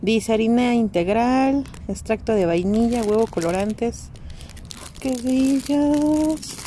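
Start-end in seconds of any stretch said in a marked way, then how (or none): 5.0-6.6 high, very slow gush. Real, not acted. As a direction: coo over the cute treats. Admiring dog cookies.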